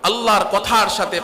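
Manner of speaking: ordinary talk, nothing marked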